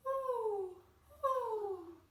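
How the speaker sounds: high, loud playful owl hoot. Pretend. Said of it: "And we hear".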